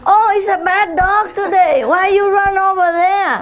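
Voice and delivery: high, cooing scold of the dog; mock sweet scolding. Neighbor scolding dog, voiced.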